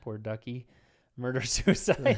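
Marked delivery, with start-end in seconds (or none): 1.2-2.2 loud, drawn-out words through laughter; laughing. Real, not acted. Podcast co-host repeats co-host's grim phrase, laughing. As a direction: crack up while saying it.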